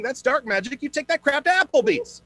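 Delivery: high, loud indignant rant; worked up. Acted. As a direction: build into a furious rant. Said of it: "Getting worked up".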